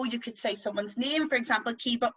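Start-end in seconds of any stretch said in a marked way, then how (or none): none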